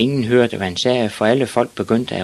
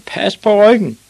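screamed; 13 semitones higher, 7 dB louder than usual. Acted. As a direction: scream advice at the players. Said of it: "skreg den gamle mand".

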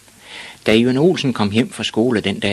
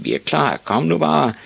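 mild mock bellow, rising higher; storybook roar, only moderately raised; bellowed reply; 8 semitones higher, 3 dB louder than usual. A narrator's reading. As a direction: call back like eager bullies. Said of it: "brølede de".

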